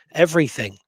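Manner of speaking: usual speaking voice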